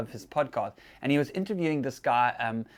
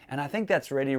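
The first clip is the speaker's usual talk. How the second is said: usual speaking voice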